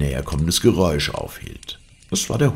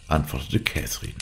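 ordinary delivery, nothing marked

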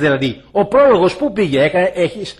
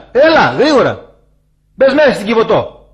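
loud, raised urgent summons; calling out; 4 semitones higher, 5 dB louder than usual. Acted. Preacher voices the bell calling people into the Ark, calling out. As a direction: call them urgently inside. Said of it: "Τι σου φωνάζει".

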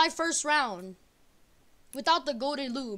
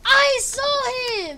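loud; 7 semitones higher, 7 dB louder, much slower than usual. Real, not acted. Defending himself, upset.

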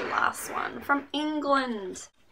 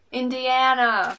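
loud, hammed-up Indiana accent; mock Indiana accent; 3 semitones higher, 8 dB louder, slower than usual. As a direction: put on a badly guessed Indiana twang. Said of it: "if that's how Indian, Indianas talk".

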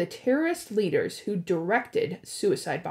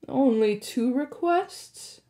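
menacing god; 4 semitones higher, slower than usual. Acted. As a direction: drawl it with contempt. Hades voiced, menacing.

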